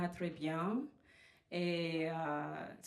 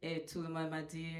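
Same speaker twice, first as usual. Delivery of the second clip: ordinary delivery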